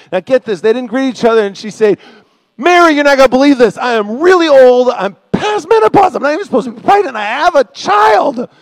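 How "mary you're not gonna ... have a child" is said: high, loud, hammed-up disbelief; mock hysterical complaint. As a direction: shout the shocking news like an overwrought gossip. Acting Elizabeth's mock complaint to Mary.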